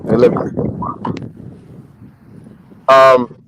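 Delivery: ordinary delivery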